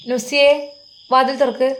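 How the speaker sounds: loud, high call through a door; a mother calling sharply to her daughter. A narrator's reading. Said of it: "ഫോണിൻ്റെ light കണ്ട് വിളിക്കുന്നു". (ഉറക്കെ നീട്ടി വിളിച്ച്)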